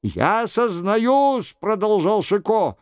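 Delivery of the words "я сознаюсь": high, very drawn-out confession; mock-royal penitent, pitched up; imitating the king. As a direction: wail out a slow confession as the king. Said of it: "продолжал за Генриха Шико".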